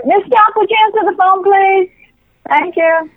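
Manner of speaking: calling out. Real, not acted. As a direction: call across the room. Mom calls to a coworker.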